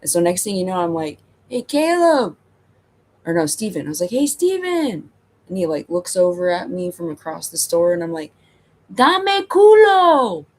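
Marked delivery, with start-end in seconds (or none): 1.5-2.4 calling out. Retold or imitated. Calling across the store. 4.1-5.1 high, drawn-out call across a room. Retold or imitated. Calling a coworker across the store, a high drawn-out call. 8.9-10.5 very high, loud, slow drawn-out yell; yelled across store. Retold or imitated. Yelling to a coworker across the store, a loud drawn-out call. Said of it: "you wanna yell".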